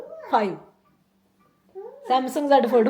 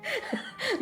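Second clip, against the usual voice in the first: short high giggle; 14 semitones higher, 8 dB quieter than usual. Real, not acted. Vlogger to viewers joking about husband's relief, giggling. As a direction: laugh at your own joke.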